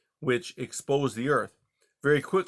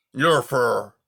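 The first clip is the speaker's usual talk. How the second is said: glum; 7 dB louder than usual. Acted. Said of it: "Era said glumly".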